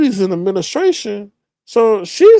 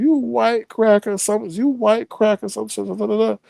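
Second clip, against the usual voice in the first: higher-pitched mock of an angry man; retold name-calling; 6 semitones higher, 4 dB quieter, faster than usual.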